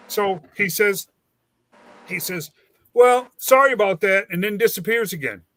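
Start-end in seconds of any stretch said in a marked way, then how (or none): 2.9-4.3 mock offhand sorry, higher and louder; half-hearted apology. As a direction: toss off the apology without meaning it. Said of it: "about a half a hearted as an apology".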